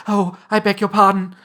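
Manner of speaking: high, loud flustered apology; sudden cry. A narrator's reading. Alice sees the mouse bristling, crying out apologetically. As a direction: break in with a startled apology. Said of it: "cried Alice again".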